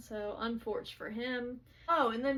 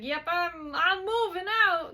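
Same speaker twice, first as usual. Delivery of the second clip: much higher, loud retold outburst; emphatic mock announcement; 8 semitones higher, 9 dB louder than usual. Roommate announces he is leaving the apartment, emphatic mock outburst. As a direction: blurt it out in exaggerated resolve. Retold hasty decision to move out.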